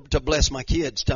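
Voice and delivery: ordinary delivery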